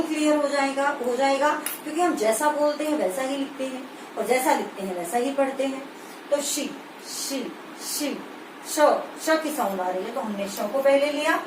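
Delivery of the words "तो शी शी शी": शी drawn out softly and slowly, the sh hissed long; sounding out shi. Shown as a demonstration. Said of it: "आप बोलकर देखेंगे".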